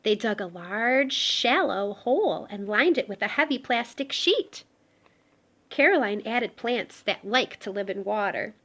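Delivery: gentle storybook read-aloud; reading to children. Acted. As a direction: tell it like a bedtime picture book. Reading picture book aloud.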